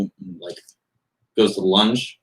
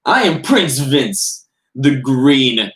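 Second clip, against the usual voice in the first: slow, grand announcement, pitched higher and louder; pompous prince; 6 semitones higher, 5 dB louder, much slower than usual. Acted. Prince Vince announcing himself to the doorman, slow and pompous. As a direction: declare your title with ceremony. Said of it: "just use your normal voice".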